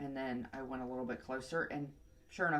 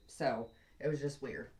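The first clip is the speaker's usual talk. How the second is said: normal talking voice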